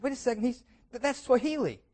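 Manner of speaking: surprised bishop. Acted. Bishop recognizes drunk's language.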